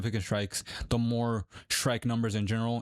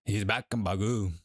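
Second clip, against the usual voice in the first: French accent attempt; slower than usual. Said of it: "That was French for you".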